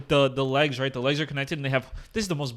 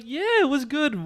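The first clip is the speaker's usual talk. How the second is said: higher, slow, playing it cool; smooth casual greeting; 11 semitones higher, much slower than usual. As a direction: slide into a smooth, laid-back hello. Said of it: "And I was like".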